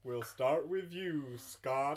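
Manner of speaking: slow, deliberate American Santa; single out a child as a hammy Santa; put-on Father Christmas, drawn out. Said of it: "keep up this accent".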